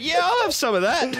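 greedy mock voice. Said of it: "he saw it and he went".

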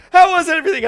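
high, loud bright server voice; chirpy waitress voice. Retold or imitated. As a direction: imitate the waitress checking in. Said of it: "she's like".

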